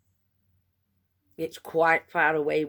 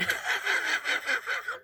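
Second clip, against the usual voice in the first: whispered giggle; 5 dB quieter than usual. Real, not acted. Laughing at embarrassing moment.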